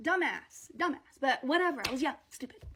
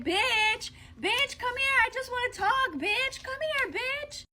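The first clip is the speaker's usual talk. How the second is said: put-on much higher voice calling the girl over; sing-song taunt to lure her out, pitched way up; mock calling out; 10 semitones higher than usual.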